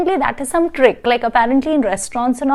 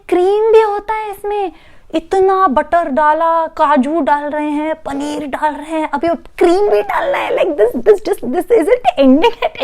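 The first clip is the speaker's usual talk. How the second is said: high, fast, whiny protest; mock anguished wail; exasperated disbelief; 5 semitones higher, much faster than usual. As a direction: pile up the complaints breathlessly. Narrator aghast at more cream.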